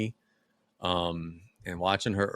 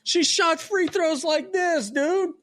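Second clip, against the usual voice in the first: much higher, slow, exaggerated voice; mocking; slower than usual. Real, not acted. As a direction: ham it up in a squeaky mock voice. Mocking daughter's ugly free throw.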